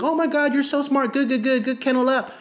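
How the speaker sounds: high, gushing puppy praise; over-the-top excited dog praise; fake excited praise. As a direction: rave at the puppy as if thrilled. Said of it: "you're like".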